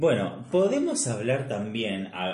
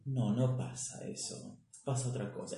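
mock denial; 2 semitones higher, 7 dB quieter than usual. Imitating people in denial to a co-host, softly dismissive. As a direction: deny it quietly and unhurriedly, in imitation. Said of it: "y ellos dicen".

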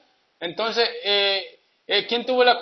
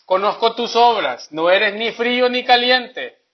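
scripture reading; 3 semitones higher, 4 dB louder than usual. Acted. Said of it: "el Apocalipsis tres quince dice".

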